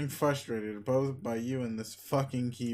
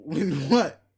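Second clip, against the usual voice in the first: laughing scoff; real laughing retort, much louder; 3 semitones higher, 9 dB louder than usual. Real, not acted. Laughs at the boast.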